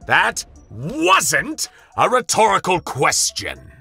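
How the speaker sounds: high, loud, slow yell; yelling boss. A narrator's reading. Boss yelling at his silent board, high and loud. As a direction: bellow it at the silent table. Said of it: "the voice yelled from its chair".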